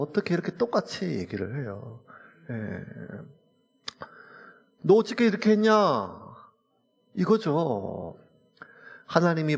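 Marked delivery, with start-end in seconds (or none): 4.8-5.7 high, loud incredulous questioning; dumbfounded demand. Acted. God confronting Eve after the fruit, dumbfounded and reproachful. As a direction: demand an answer in exasperation. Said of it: "기가 차서".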